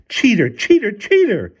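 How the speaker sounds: high, loud accusing shout. A narrator's reading. Wife kicking at the locked door, shouting. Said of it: "kicked at the door and shouted".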